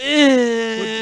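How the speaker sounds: high, drawn-out "ehhh"; mock whiny "eh" noise; imitated actor's noise. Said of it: "for the entire movie, he could have just".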